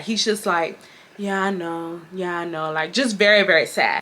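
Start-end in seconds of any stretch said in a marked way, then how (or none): 1.2-2.7 softer, mock-dejected sigh of a reply; imitating sad coworker. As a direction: mumble it like a crushed coworker, much softer. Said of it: "He's very sad".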